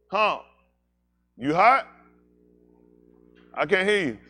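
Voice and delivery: loud call across the room, rising on hot. Real, not acted. Host calling out to Humpback, loud and teasing. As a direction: shout the question to him.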